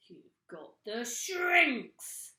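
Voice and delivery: very slow, loud accusing cry; gloating cry. A narrator's reading. Mr Twit pointing at his wife, crying out slowly. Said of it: "cried Mister Twit".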